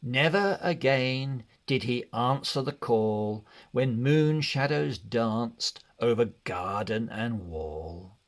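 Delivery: plain reading voice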